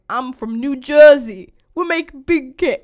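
slow, loud mock New Jersey accent. Friends imitating the Cake Boss in a New Jersey accent. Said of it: "I have the worst New York accent".

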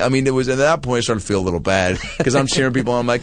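normal talking voice

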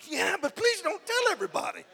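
high comic squeal; mock embarrassed plea. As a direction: plead in a put-on high voice. Mock embarrassed reply to accuser.

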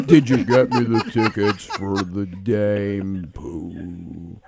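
slow, low, mopey donkey drawl; Eeyore voice. Retold or imitated. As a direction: drag it out like a gloomy Eeyore. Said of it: "You sound like Eeyore from Winnie the Pooh".

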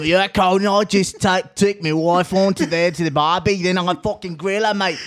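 put-on Australian accent. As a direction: ham up Jim Jeffries talking to Conan. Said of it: "He's not that Australian, man".

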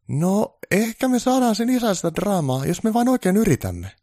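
very high, loud elf voice; keen, squeaked-up reassurance; eager elf foreman, placating. Acted. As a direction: offer hopeful help to an angry boss. Voices Onni offering to fix it.

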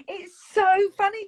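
ordinary delivery

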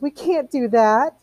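high, drawn-out wail. A narrator's reading. Missus Bowles objecting to hearing a poem, wailing. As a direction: whine the protest slowly and high. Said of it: "wailed Missus Bowles".

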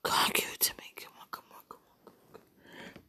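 pleading whisper, fading away; urgent whispered plea. Real, not acted. Begging a lover over the phone, whispered. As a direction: whisper it, begging under the breath.

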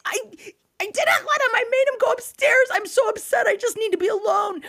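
very high, wailing meltdown; hammed-up hysterical whine; losing it. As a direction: imitate a frazzled parent's tearful shriek. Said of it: "just about lost my fucking mind".

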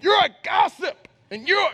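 hammed-up preacher naming a gossip; preacher calling names. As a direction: call out sinners by name from the pulpit. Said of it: "calling names out".

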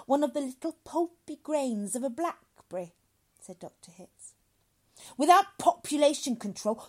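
high, loud, emphatic lecture; severe lecturing doctor. Acted. Doctor explains overpopulation to father, loudly emphatic. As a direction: declaim it with relish and force.

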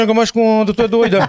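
hammed-up Paraense mumble, lips barely parting; barely open mouth. Shown as a demonstration. Showing friends how Paraenses talk, mouth barely open. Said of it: "não abre a boca pra falar".

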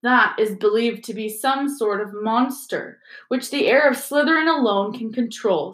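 plain reading voice

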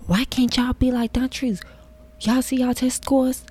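lightly voiced scolding teacher; mock a teacher's reproach; a hint of a scolding teacher. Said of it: "all the teachers just".

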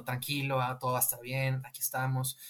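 soft, consoling mother's voice; calm the boy gently, said softly. Retold or imitated. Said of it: "consolándolo". (consuelo suave de madre)

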